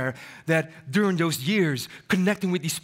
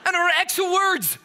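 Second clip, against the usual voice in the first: playful shout; 11 semitones higher than usual. Real, not acted. Speaker teasing the audience about Arabic, playful shout. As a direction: call it out teasingly to the room.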